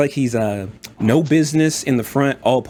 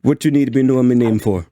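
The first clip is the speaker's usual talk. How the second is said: quick, suspicious Jamaican-ish lilt; wary dwarf refusing his name, Jamaican-like accent; unique dwarf accent; faster than usual. Acted. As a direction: toss it back fast in the dwarf's lilting accent. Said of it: "a unique accent for dwarves".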